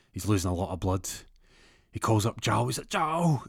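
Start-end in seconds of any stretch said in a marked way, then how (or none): 2.9-3.4 high, hammed-up cry for help; urgent call. Shot burglar phoning his friend, high urgent call. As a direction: call the name pitched way up.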